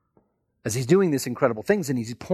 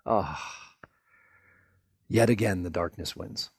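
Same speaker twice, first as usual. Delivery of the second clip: dejected followers; 4 semitones lower, 4 dB quieter than usual. Acted. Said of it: "all that were following went".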